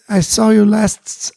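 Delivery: ordinary talk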